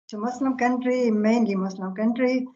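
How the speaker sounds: ordinary talk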